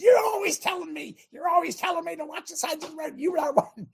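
high pitched retold yell at the driver; wife yelling. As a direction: snap it out like an upset wife in the passenger seat. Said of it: "she's yelling at me".

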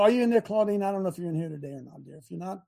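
ordinary delivery

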